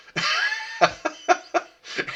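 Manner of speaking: big high burst of laughter; loud laugh. Real, not acted. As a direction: crack up at the memory. Remembering vinyl seats ripping.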